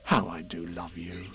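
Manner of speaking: low, soft, loving; quiet heartfelt fondness; tenderly affectionate. A narrator's reading. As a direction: drop low and gentle. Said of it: "said Christopher Robin".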